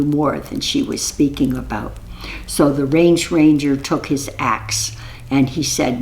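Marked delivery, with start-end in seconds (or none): none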